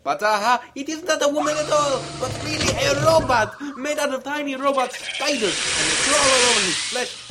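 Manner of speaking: high, loud evil delight; gleeful villain reveal. Acted. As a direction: relish every horrible detail. Villain reveals robot spider woman.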